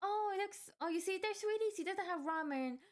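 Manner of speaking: put-on high, sugary mother to child; sweet mom voice. Mom soothing her little kid, high and sweet. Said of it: "I was like".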